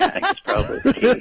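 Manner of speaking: laughing through the words. Real, not acted. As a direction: chuckle while saying it. Joking about drinking at festival.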